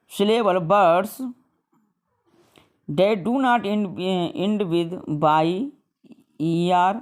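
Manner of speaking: slow, deliberate reading of a textbook rule; slow rule dictation. Shown as a demonstration. Teacher reading a grammar rule to students, slow and deliberate. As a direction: spell the rule out slowly for the class. Reads English rule aloud slowly.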